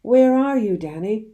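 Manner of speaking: very loud, high call; father calls to his son through the trees. A narrator's reading. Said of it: "my father called out".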